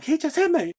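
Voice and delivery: high, loud mock accusation; retold yelling. Girlfriend accusing him after he knocks the knife, high yell. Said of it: "she started yelling".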